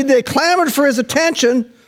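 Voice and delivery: high, fired-up sermon delivery; impassioned preacher in full flow; full-force preaching. Acted. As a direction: preach it urgently, piling phrase on phrase.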